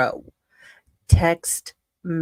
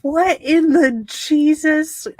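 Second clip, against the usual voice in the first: much higher, louder disbelieving outcry; shocked; 9 semitones higher, 6 dB louder than usual. Real, not acted. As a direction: cry out in stunned disbelief. Shocked reaction to absurd email.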